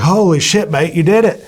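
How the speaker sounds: loud, high-pitched imitation of astonished mates; amazed exclamation. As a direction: retell their thrilled discovery, voice leaping up. Contacts find the hidden cocaine.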